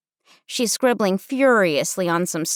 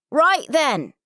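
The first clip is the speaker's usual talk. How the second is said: high, brisk British counselor; heavy British accent; 7 semitones higher than usual. Acted. Counselor shouting at the campers on the bus, heavy British accent. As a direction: call out in heavy British English. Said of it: "she says in a heavy British accent".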